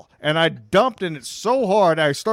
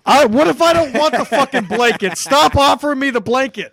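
angry rant; 7 semitones higher, 5 dB louder than usual. Acted. Ranting about charity blanket commercials.

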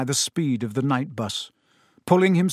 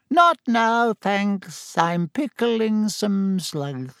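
high, drawn-out voice of a tiny sleeping wizard; tiny wizard mumbling from a dream; sleepy mutter; 6 semitones higher, much slower than usual. A narrator's reading. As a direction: talk in your sleep, slowly trailing down. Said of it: "A tiny wizard in a nightcap at the rear of the bus muttered".

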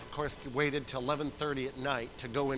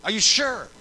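raised, loud astonished questions; retold disbelief; 7 semitones higher, 11 dB louder than usual. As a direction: imitate an incredulous woman in labor. Wife's surprised retold reply.

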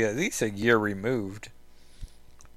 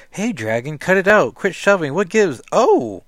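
loud, higher-pitched protest; annoyed shout; 7 semitones higher, 11 dB louder than usual. Acted. Kitty to the dragon shoving her, loud annoyed yelling. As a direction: holler at a pushy pet to stop.

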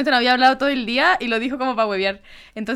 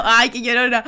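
light teasing, lead with a drawn AY; playful mock reproach; joking second tone; slower than usual. Shown as a demonstration. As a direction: tease a friend as a joke. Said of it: "yo lo dije con ese segundo tono".

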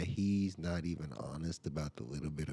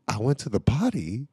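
put-on higher, louder kid voice; kid's insistence; 6 semitones higher, 8 dB louder than usual. Son insisting to parents he used the potty. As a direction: have the boy stubbornly repeat his claim.

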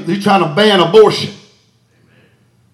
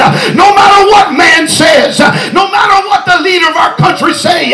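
preaching at full force; 4 semitones higher than usual. Real, not acted. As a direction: preach it out, building to the peak. Shouted sermon climax.

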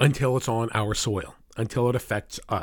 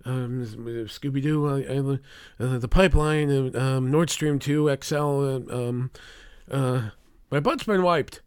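mock doddering, halting mumble; Biden; 2 semitones higher, slower than usual. Host mocking the president losing his train of thought, halting impression. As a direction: fumble through it with dragged-out ums and uhs. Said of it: "Biden, of course".

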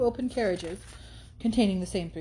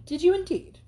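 much higher and louder; startled; 9 semitones higher, 5 dB louder than usual. Acted. Catherine hears Tilney drove off, startled and high. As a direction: jump at the news.

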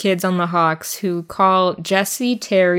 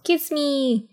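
higher, softer swooning plea; Laurie impression; 6 semitones higher, 5 dB quieter than usual. Imitating Laurie asking Jo for a kiss, high and soft. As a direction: breathe it out longingly. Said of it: "That's my impression of Laurie".

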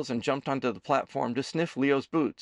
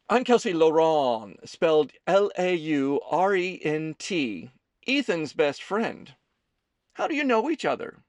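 higher, bright-pitched best friend taking center stage; lightly voiced Kelsey; 5 semitones higher, 5 dB louder than usual. A narrator's reading. Kelsey introduces herself to Leo, higher and pushy.

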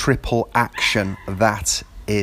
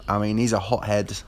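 ordinary delivery

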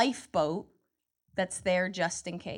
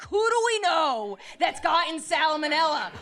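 dismissive men; 7 semitones higher than usual. Retold or imitated. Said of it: "they're like".

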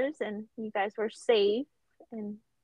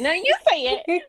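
higher, louder teasing sing-song; giggly late night call; 7 semitones higher, 7 dB louder than usual. Pretend. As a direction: tease it back like a late-night phone call. Playful back-and-forth over who speaks.

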